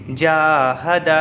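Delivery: जाहदा with the जा held long and दा short; जाहदा said very slowly, long first vowel; drawn-out first syllable. Shown as a demonstration. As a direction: stretch the जा in जाहदा, then clip दा. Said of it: "इसको खींचेंगे".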